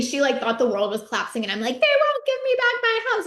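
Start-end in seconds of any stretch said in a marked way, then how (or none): 1.8-3.3 much higher, louder tearful wail; imitating scream sobbing. Telling Sarah about the house, mock sobbing wail. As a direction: relive the meltdown in a high cry. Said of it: "I was scream sobbing".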